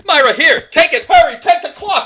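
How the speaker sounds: very high, loud urgent calls; frantic shout. Acted. Suspect passing clock to ally, frantic. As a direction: yell for someone to take it fast.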